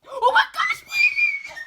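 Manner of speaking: piercingly high, loud squeal; excited shriek. Real, not acted. Finding the photo cards.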